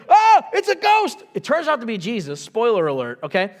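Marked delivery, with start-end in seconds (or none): 0.1-1.2 high, slow, loud frightened shriek; scared disciple. Retold or imitated. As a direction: squeal it like a spooked disciple. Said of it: "that one guy goes".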